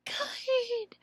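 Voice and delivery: whispered praise. Shown as a demonstration. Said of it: "say the sound".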